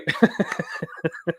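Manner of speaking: a short unvoiced laugh at his own joke; laughing at his own Godzilla line; breathy laugh. Real, not acted.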